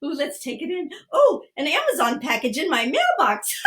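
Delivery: mock-amazed and giggly; pretend delighted laughing. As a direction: play up the find, then burst out laughing. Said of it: "we're gonna laugh".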